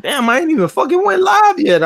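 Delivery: high, loud retold outburst; mock-outraged squawk of protest; indignant disbelief. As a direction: act out your own outraged disbelief, voice jumping way up. Acts out own outraged reaction.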